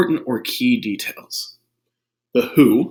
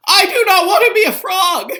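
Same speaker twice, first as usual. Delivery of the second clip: very high, loud panicked cry; high, loud frightened protest; panicked Gerald; 8 dB louder than usual. Acted. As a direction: wail in a panic.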